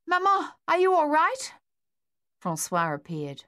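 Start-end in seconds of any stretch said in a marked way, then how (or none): 0.1-1.5 put-on much higher, louder young boy calling out in worry; a frightened son rushing in to check on his mother; worried boy. A narrator's reading.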